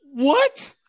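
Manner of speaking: high, loud mock-shocked outburst; incredulous retort. Retelling his shock at the bum's insult, incredulous. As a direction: act out a stunned yelp of disbelief.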